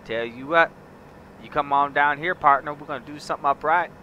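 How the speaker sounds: loud, hammed-up southern accent like a ranch hand; southern ranch talk. Gamer inviting viewers down south, loud mock southern accent. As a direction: lay on a big Southern accent, welcoming a partner. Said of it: "I could go all southern on you".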